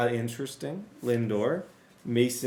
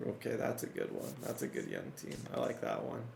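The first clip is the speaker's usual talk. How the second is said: whisper; 11 dB quieter, faster than usual. Real, not acted. Sudden whispered asides.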